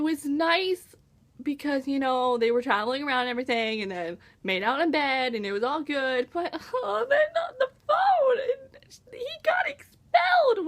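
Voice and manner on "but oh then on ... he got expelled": high, half-laughing wail; mock anguish over a sad book; tearful laugh in a raised voice; laugh-crying. Real, not acted. Recalling sad plot twist.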